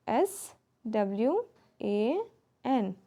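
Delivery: each letter set apart, unhurried; slow spelling. Shown as a demonstration. Teacher slowly spelling swan for the children. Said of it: "spelling word letter by letter".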